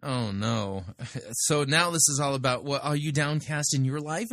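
normal talking voice

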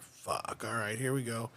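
hushed, resigned whisper under the breath. Retold or imitated. Nervous fan whispering as the wrestler walks over, hushed. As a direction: brace yourself, barely voiced.

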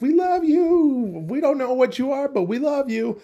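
put-on very high, very slow cooing baby bird voice; squeaky, drawn-out baby birds. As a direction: coo it like tiny adoring chicks. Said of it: "they're like".

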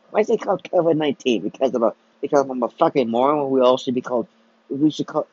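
usual speaking voice

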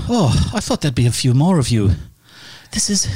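a light Irish lilt, quick and chatty; lightly Irish-accented woman, a bit rushed; accidental Irish accent. Acted. GM voicing the lady, drifting into a light Irish accent. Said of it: "She sounds Irish".

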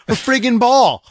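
high, loud exasperated burst. Real, not acted. Exasperated parent venting.

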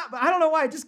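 high, laughing embarrassed admission. Real, not acted. Host owning up to a cringy accent, laughing.

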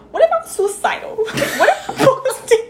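high, laughing TikTok quote. Retold or imitated. Quoting a dramatic TikTok to her friend, laughing. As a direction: read it out while cracking up. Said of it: "the TikTok was like".

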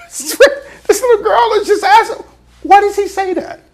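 high, laughing through the words; can't keep from laughing. Real, not acted. Preacher amused that Peter lied to a girl, laughing.

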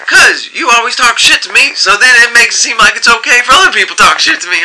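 high, fast whiny complaint; whiny coworker complaint. Retold or imitated. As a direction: rattle it off like a wronged coworker.

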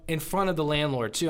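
ordinary delivery

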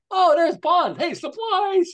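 high loud happy call; high yelled "Hey, supplies!"; excited shout. Retold or imitated. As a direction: greet him with a big cheer. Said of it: "they go like".

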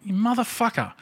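high, outraged exclamation; a high, outraged curse at the player; indignant curse. Retold or imitated. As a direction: curse him out in disbelief. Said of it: "And I thought".

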